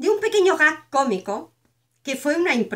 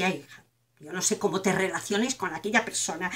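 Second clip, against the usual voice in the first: lower, softer voice of a fretting mother; disapproving mother; 8 semitones lower, 8 dB quieter than usual. Retold or imitated. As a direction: sigh it out as a worried, disapproving mother.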